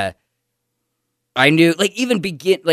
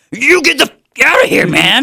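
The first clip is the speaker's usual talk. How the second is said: angry badger yell; 12 semitones higher, 4 dB louder than usual. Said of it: "the badger's like".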